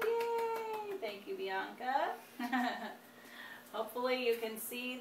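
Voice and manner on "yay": cheering. Real, not acted. Cheering for daughter.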